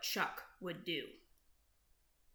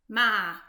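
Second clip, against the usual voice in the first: louder mock bleat; goat bleat; 9 dB louder than usual. Goat's bleat in story.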